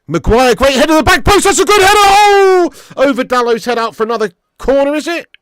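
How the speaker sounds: loud, high, thrilled commentary; excited commentary. Real, not acted. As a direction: call the header at full excitement. Live reaction to chance.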